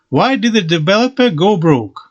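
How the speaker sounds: normal talking voice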